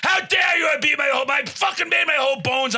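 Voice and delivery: high, hammed-up outraged shout; indignant yelling. Contestant yells at everybody at trivia, indignant. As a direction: yell it like a personal insult. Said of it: "I started yelling at fucking everybody".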